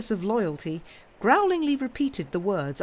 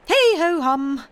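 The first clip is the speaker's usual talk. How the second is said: much higher, louder forced cheer; cheerful sigh; 10 semitones higher, 10 dB louder, slower than usual. Acted. Miss Pross masking worry before Lucy, loud forced cheer. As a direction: brighten up to hide a sigh. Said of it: "cheerfully repressing a sigh".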